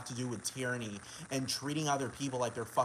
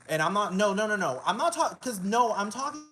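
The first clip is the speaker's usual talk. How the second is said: higher, louder string of insistent no's; agitated objection; 5 semitones higher, 5 dB louder than usual. Real, not acted. Speaker breaking off to correct who they mean, agitated. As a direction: cut in insistently, pitch jumping up. Cutting off an objection mid-rant.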